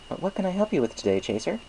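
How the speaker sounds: high, friendly shopkeeper pony voice; chirpy baker pleased with business; Mister Cake's voice. A narrator's reading. Baker thanks Applejack for the apples, chirpy and friendly. Mister Cake offers help.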